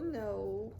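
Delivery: plain reading voice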